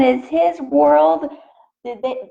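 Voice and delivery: ordinary delivery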